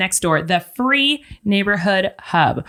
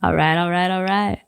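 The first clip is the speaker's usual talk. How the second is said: Matthew McConaughey; 5 semitones lower than usual. Retold or imitated. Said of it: "Matthew McConaughey?".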